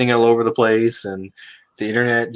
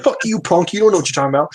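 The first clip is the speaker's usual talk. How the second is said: higher-pitched mock defiance; defiant worker; 6 semitones higher, faster than usual. Older worker talking back to his younger boss, mock defiant. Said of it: "He's gonna be like".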